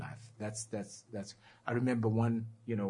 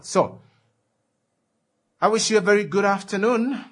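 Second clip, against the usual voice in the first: loud sign-off; 10 semitones higher, 11 dB louder than usual. Real, not acted. Radio host signing off.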